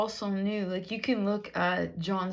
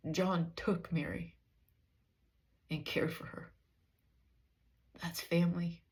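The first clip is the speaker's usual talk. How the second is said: softer, half-whispered, choked up with feeling; emotional, hushed; 3 semitones lower, 4 dB quieter than usual. Real, not acted. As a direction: let real emotion pull the voice down to a hush. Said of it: "I don't know why I'm so emotional".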